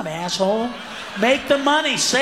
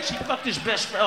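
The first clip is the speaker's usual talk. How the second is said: ordinary talk, nothing marked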